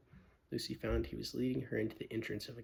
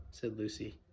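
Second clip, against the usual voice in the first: ordinary delivery, nothing marked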